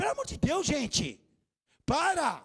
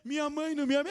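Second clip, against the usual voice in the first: mock whining; 4 semitones higher than usual. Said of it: "E eu não fiquei chorando pelos cantos".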